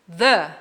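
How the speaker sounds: "the" with a short uh vowel; "the" as thuh. Shown as a demonstration. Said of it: "tem som de consoante".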